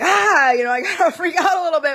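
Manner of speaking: sudden high loud yelp, then rushed; mock panic, played for laughs; anxious freak-out. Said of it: "I do get anxious".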